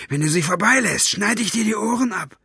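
high-voiced threatening order; commanding. A narrator's reading. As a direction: threaten the underling as you order him. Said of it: "befahl er dem Wächter".

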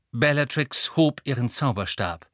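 plain reading voice

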